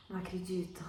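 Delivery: soft, low-key disbelief; quiet disbelief. Shown as a demonstration. Actor reacting to an imagined mother's reply, quiet disbelief. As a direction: let it out softly, as if absorbing an answer. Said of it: "dar o tempo da interpretação".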